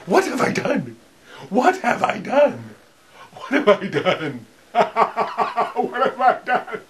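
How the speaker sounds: high, half-laughing mock horror. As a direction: laugh through mock dismay. Playful horror at creation.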